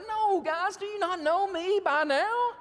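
high-pitched, mock-weeping reassurance; imitated tearful protest. Joseph weeping to his brothers, imitated tearful protest. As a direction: protest as if hurt, pitched well up. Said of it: "he's just weeping over it like".

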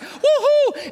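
high excited woohoo with a laugh; excited whoop. Retold or imitated. Peter celebrates the revival with a high joyful whoop. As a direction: whoop it up with joy.

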